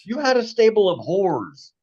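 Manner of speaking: high, slow mock accusing; high teasing. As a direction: tease him loudly. Teasing joke.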